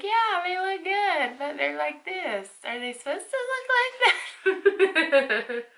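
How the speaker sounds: high, slow, exaggerated imitation of Tim; teasing impression of a baffled boyfriend, high and drawn out; mocking boyfriend. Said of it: "he's like".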